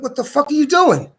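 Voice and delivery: high, sharp mock yell; high-pitched retold what are you doing; retold outburst. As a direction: blurt it out in shock at the intruder. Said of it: "I'm like".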